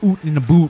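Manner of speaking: "oot in a boot" with the Canadian oo vowel for "oot" and "boot". Shown as a demonstration. Streamer demoing the Canadian "oot" vowel for chat. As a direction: stretch "oot" like a Canadian saying out. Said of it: "you might, like".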